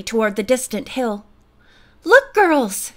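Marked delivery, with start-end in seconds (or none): 2.0-2.9 higher, louder excited call; sudden eager outburst; excited exclamation. A narrator's reading. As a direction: point it out eagerly to friends. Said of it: "she exclaimed".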